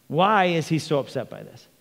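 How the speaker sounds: normal talking voice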